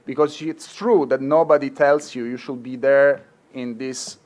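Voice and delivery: usual speaking voice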